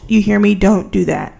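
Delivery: loud, mock-stern warning; close to the mic. Vlogger warning college students off scraping by, loud up close. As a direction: lean into the mic and warn firmly. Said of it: "I got really close to the mic".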